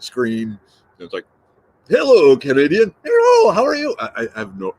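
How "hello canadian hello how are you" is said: high, sing-song mock Canadian hello; mock Canadian greeting. Teasing host mimics his Canadian guest, sing-song and high. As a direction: ham up a cheery Canadian greeting. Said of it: "she doesn't sound like that".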